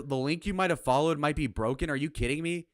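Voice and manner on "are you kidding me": normal talking voice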